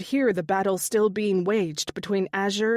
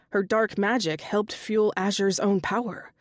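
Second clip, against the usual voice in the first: ordinary delivery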